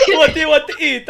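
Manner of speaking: high comic waiter. Acted. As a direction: stretch it out, high and silly. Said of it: "this is the voice I'm gonna use now".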